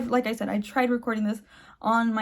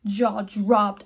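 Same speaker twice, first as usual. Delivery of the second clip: usual speaking voice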